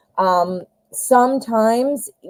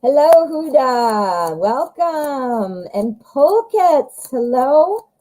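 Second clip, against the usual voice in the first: warm greeting; 5 semitones higher, much slower than usual. Real, not acted. Greeting chat viewers.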